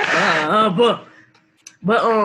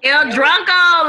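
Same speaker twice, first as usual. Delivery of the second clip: excited drawn-out echo; 7 semitones higher, 5 dB louder, slower than usual. Real, not acted. Echoing nickname, drawn out louder.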